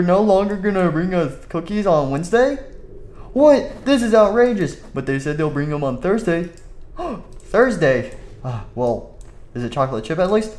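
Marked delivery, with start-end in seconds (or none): 3.3-4.8 high, loud outrage; outraged. Acted. Boss outraged. 6.5-7.3 shocked gasp. Acted. Gasp at Thursday.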